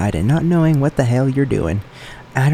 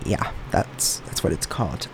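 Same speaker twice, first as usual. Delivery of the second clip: whispered aside; 7 semitones lower, 10 dB quieter than usual. Real, not acted. Aside after saying homosexual.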